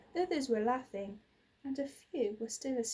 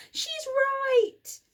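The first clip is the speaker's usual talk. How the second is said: loud, much higher fearless shout; 10 dB louder than usual. A narrator's reading. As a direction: belt it out boldly. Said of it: "Ella shouted".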